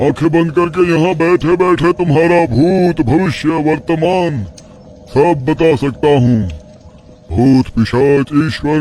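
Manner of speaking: a proud sorcerer bragging of his powers; boastful tantric sage. Acted. Father voices the mysterious sage.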